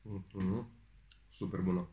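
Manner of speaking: talking while chewing food; muffled words around food; mouth full, chewing. Real, not acted. Seen 1: Took a bite at -4; lips still moving and pursed, chewing while speaking.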